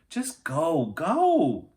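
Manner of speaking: loud high push, go go; impatient urging. A narrator's reading. Annie impatiently pushes Jack out, urging him on. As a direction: hurry him along.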